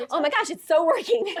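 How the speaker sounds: high, loud words through giggles; acted laughing speech. Telling the nurse the drug works, high and laughing.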